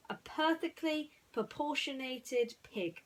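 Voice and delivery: slow, high, tactful finish; Woody, careful. Acted. Woody reassuring Ham, slow tactful reassurance. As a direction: choose each word carefully to spare his feelings. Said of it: "he finished".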